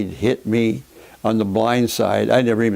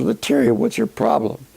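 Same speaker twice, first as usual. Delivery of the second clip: mock-indignant call, pitched way up; retold challenge; 12 semitones higher than usual. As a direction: fire it at him high and sharp, like a challenge. Confronting opponent on second base.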